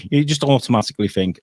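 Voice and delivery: ordinary talk, nothing marked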